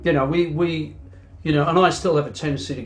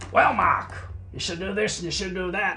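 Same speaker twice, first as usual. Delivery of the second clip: a bad Scottish impression of George giving advice; terrible Scottish accent; 3 semitones higher, 3 dB quieter than usual. Musician imitates his old producer in a bad Scottish accent. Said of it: "Sorry about the terrible Scottish accent".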